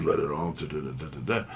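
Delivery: ordinary talk, nothing marked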